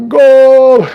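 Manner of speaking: very high, loud, held cry; soccer announcer goal shout. Acted. Streamer mimics the soccer guy after a touchdown, shouting. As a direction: belt out a long goal call. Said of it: "they need the soccer guy".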